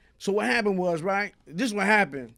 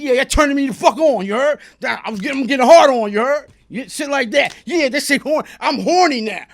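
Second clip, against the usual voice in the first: loud, raised-pitch bragging; acted defiant taunt; 5 semitones higher, 8 dB louder than usual. Storyteller replays taunting the men beating him, loud defiant relish. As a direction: goad the attackers as if loving it.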